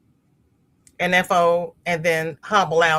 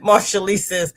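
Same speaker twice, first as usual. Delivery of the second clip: ordinary talk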